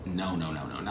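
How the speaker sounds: low, soft panicked no's; losing balance, muttering no; panicked murmur. Retold or imitated. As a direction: mutter a fearful no over and over. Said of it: "So I was just like".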